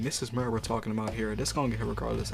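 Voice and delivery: ordinary talk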